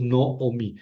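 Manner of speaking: Noomi with O in both first syllables; long double O in Noomi; correct reading Noomi. Shown as a demonstration. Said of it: "leo O en los dos casos".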